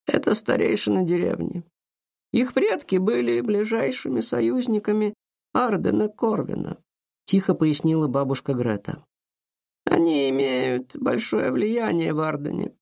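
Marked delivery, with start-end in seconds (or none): 0.1-6.8 lightly voiced grandmother; a hint of an old woman. A narrator's reading. Grandmother explains to Aurora. 9.9-12.8 slow, lightly voiced grandmother; a hint of an old woman. A narrator's reading. Grandmother explains quietly.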